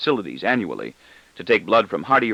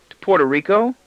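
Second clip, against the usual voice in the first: put-on boy's voice; 10 semitones higher, 4 dB louder than usual. A narrator's reading. Boy voiced, asking.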